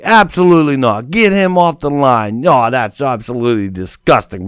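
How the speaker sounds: imitating the radio host blowing up; indignant outburst. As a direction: bark it like an offended host. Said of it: "he's like".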